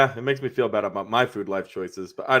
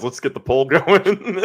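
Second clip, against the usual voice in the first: laughing; 5 semitones higher, 4 dB louder, slower than usual. Real, not acted. Joking about poll.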